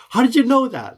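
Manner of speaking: high incredulous question; amazed stranger. Retold or imitated. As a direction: play the stunned stranger, voice jumping up high.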